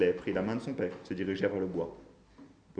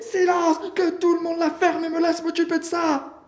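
high, loud, frantic order; yelling; 9 dB louder than usual. A narrator's reading. Bailey taking charge before the gunmen, yelling. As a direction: yell the whole order at full force. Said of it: "hurla Bailey".

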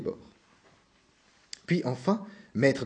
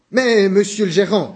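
slow, louder and higher urgent call; crying out; 4 semitones higher, 6 dB louder, slower than usual. A narrator's reading. Gregor calling to the manager through the door, loud. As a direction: call out to stop him. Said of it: "cria-t-il".

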